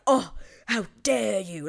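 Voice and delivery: prim, scandalized mock outrage; mock offended gasp. Sheffields offended at the Bridgertons, hammed-up indignation. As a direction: clutch your pearls, hammed-up. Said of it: "the Sheffields are all like".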